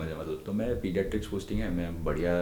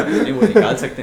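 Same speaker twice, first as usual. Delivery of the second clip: higher, louder, still laughing; 12 semitones higher, 11 dB louder than usual. Real, not acted. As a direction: talk through the giggles. Answering while still laughing.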